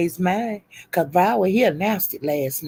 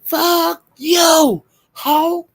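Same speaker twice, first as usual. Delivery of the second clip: high, drawn-out mock insult; demonstrated cuss-out; 10 semitones higher, much slower than usual. Shown as a demonstration. Showing Lola how to do it, demonstrated cuss-out. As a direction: stretch each word up high. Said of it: "Do that and say".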